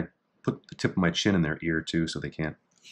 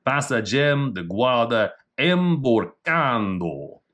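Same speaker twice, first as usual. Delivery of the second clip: Portuguese reading; 3 semitones higher, much slower than usual. Shown as a demonstration. Reading Portuguese technique name.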